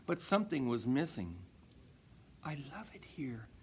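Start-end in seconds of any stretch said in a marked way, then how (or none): 2.5-3.5 hushed, wistful whisper; soft whisper. Acted. Dasher telling Santa she loves it, whispered. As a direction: confide it softly.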